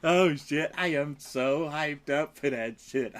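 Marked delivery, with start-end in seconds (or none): none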